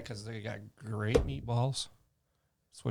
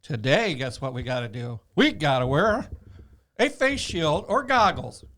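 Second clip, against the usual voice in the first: hammed-up official decree, pitched up; mock expert announcing; 2 semitones higher, 4 dB louder, slower than usual. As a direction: announce each new rule as a parody expert. Mimics expert announcing new rule.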